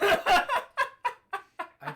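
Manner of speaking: laughing. Real, not acted. Surprise at a distress beacon.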